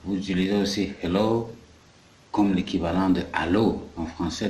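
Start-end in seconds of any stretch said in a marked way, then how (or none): none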